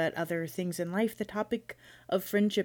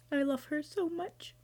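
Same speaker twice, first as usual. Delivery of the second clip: high, fast pretend weepy voice; playful mock sob; mock crying; 11 semitones higher than usual. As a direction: fake tearing up, half joking. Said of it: "No, I'm kidding".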